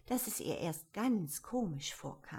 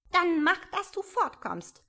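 high, sour brush-off; pigeon, cross and grumpy, shooing her off; peevish; 10 semitones higher, 9 dB louder than usual. A narrator's reading. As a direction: snap at her to leave. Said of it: "sagte die Taube verdrießlich".

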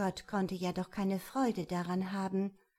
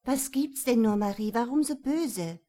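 lightly voiced mother, raised questioning; louder, higher interjection; mother calling in; 3 semitones higher, 7 dB louder than usual. A narrator's reading. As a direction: call out a puzzled question. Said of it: "Da tönte der Mutter Stimme dazwischen".

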